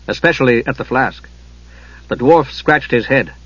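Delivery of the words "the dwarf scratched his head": ordinary delivery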